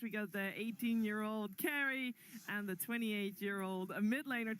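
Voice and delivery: ordinary delivery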